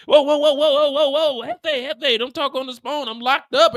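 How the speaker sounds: high, loud whoa-whoa alarm; panicked warning. Retold or imitated. Cruz warning the boss off the phone, high and urgent. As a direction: stop him fast.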